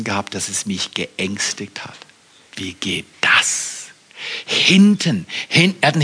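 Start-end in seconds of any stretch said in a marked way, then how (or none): 2.6-3.9 slow, fearful whisper; slow hushed wondering; whispered question. Real, not acted. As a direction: whisper it anxiously. Recalling fear of chest pain.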